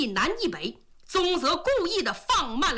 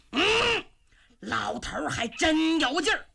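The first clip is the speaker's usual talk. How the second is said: ordinary delivery, nothing marked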